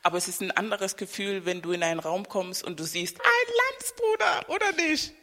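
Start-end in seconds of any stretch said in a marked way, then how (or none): none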